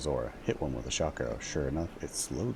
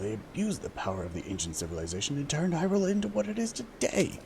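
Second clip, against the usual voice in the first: put-on bitter old advisor; 8 semitones higher than usual. Advisor reminding the king of past wrongs, higher and bitter. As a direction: rail against the Hylians with old grudges. Advisor argues against the Hylian.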